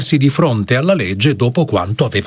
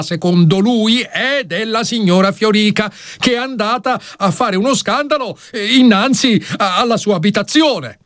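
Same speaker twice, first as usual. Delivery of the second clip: higher, urgent pleading; arguing lawyer; 10 semitones higher than usual. Acted. Lawyer argues with the judge, higher urgent plea. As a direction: argue it fast and earnestly.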